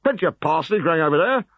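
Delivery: lightly voiced pleased officer.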